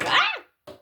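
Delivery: sudden high yelp; high shriek. Acted. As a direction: squeal as if struck. Scream when hit.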